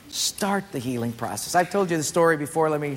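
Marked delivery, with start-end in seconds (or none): 0.1-1.5 softer echo. Shown as a demonstration. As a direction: Start the healing process, dropped much softer.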